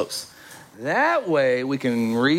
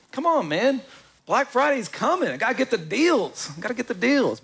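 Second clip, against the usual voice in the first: mock eager shopper; 6 semitones higher than usual. Mocking a deal-hungry shopper.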